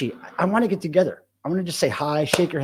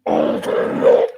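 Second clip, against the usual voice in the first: Overlord whispered low and very slow; whispered demon Overlord; 11 semitones lower, 4 dB louder, much slower than usual. Shown as a demonstration. Streamer corrects his first take, whispering Overlord slow and low. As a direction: hiss Overlord like something from the pit. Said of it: "but you gotta say it like this".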